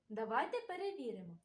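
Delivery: ordinary delivery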